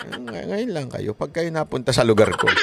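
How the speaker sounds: soft, muttered private threat. Retold or imitated. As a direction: mutter it under the breath like a grudge. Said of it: "ganun ang nasa isip niya". (pabulong na banta sa sarili)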